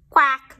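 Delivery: a duck calling; loud duck quack. Acted. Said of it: "says the duck".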